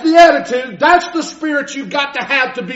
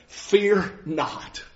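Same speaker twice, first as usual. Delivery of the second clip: much softer, lower reassurance; tender comfort; 4 semitones lower, 9 dB quieter than usual. Acted. King David gently reassures the trembling Mephibosheth. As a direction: soothe him gently.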